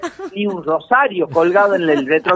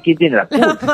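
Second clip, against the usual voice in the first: higher-pitched, mock-alarmed echo of the question; 5 semitones higher than usual. Nervous passenger echoing the driver's test question, mock alarm. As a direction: repeat the question as a stumped passenger. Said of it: "Uy, vos decís".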